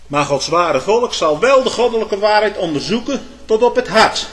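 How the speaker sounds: loud, emphatic preaching, key words lifted high; krachtige preek. Real, not acted. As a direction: preach it forcefully to the congregation. Preacher at full force. (met stemverheffing gepreekt)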